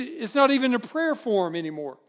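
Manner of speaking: ordinary talk, nothing marked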